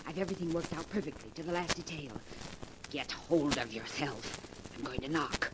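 soft, firm conspirator's instructions; hushed and commanding. Acted. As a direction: keep your voice down and steady your accomplice. Plotting outside aunt's door.

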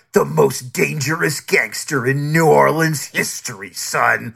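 low, hammed-up warning about a gangster; mock-dramatic hype. Critic mocking a mobster's overblown introduction, mock-ominous. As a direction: drop low and lightly mock the menace.

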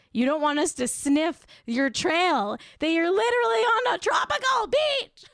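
builds to a high, loud shout; calling her out. Real, not acted. To the host about her tropical beach, a building shout. Calling out host's throwback posts.

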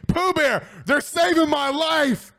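high, bright thankful outburst; delighted relief. Real, not acted. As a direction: cry out in real relief at the fans. Fans vote not to shave.

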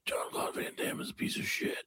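whispered action-star send-up; very quiet, menacing mock whisper; Steven Seagal. Host tries his Seagal accent, sinister whisper. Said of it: "how to do a Steven Seagal accent".

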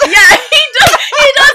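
high, loud laughing agreement; laughing shout. Real, not acted. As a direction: burst out agreeing through laughter.

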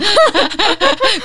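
loud falling laugh; high laugh. Real, not acted. Laughs at age joke.

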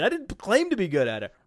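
usual speaking voice

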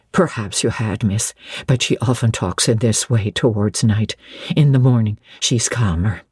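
low, understated aside to a visitor; discreet servant. A narrator's reading. Narrator voices Bessie the servant.

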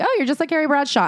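high-pitched mock compliment; imitated gushing. Host imitates people comparing the writer to Carrie, imitated gushing.

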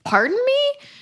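high, squeaky mock disbelief; mock incredulous. Cohost retelling his shocked reply, high mock disbelief. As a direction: act stunned, pitching it way up. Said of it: "And he's like".

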